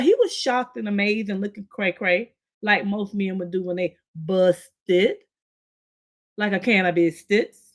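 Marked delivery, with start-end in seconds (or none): none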